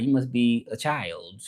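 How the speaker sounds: usual speaking voice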